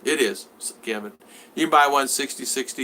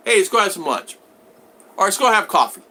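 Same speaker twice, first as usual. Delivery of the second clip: brightly raised and louder, like proposing a fun outing; excited invitation; 6 semitones higher, 6 dB louder than usual. Retold or imitated. Host imitating a fan's eager invitation to lunch. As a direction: pitch it up, eager to make plans together.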